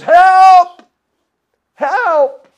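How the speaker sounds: loud high panicked yell, rising over the story voice; screams for help. Acted. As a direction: cry out for help in sheer panic. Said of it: "and he screams".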